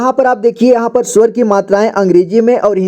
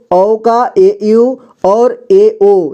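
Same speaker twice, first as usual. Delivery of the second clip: each letter set apart, very slow; slow dictation; much slower than usual. Shown as a demonstration. Teacher slowly dictating spellings to students, very slow.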